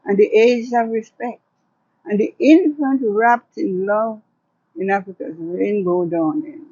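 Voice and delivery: measured, rhythmic poetry recital with long pauses; poem reading. Acted. Poet reads her poem to the interviewer, measured and solemn. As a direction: read it like a hopeful vision of Africa. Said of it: "So can you share one of your poems here?".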